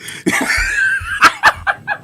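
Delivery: squealing cackle; real high laughing fit; high-pitched laugh. Real, not acted. Laughing at friend.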